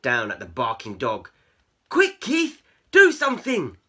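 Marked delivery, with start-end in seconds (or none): 1.9-3.8 high, loud urgent plea; panicked cry. A narrator's reading. Cats up the tree begging Keith, panicked cry. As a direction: cry out for rescue. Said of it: "they cried".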